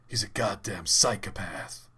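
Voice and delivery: British speaker's put-on American accent. Mimicking British actors playing Batman, in an American accent. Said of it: "a British person does an American accent".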